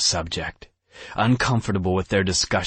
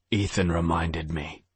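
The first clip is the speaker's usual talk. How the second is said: ordinary delivery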